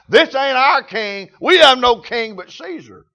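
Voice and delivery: defiant rejection. Retold or imitated. Preacher voicing the people rejecting Jesus, defiant. Acted crowd rejecting their king.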